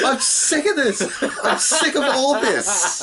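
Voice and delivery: high-pitched, hammed-up whining fit; mock tantrum. Co-host mimicking a wrestler's tantrum on stage, high hammed-up fit. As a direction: throw a comic tantrum, pitch way up. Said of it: "Maverick throws a tantrum on the stage".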